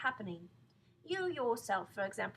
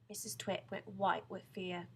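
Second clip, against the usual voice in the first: ordinary delivery, nothing marked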